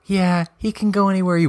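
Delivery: mimicking fans who praise Franco, gushing and high; mocking fans. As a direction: parody someone gushing over him. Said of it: "when people be like".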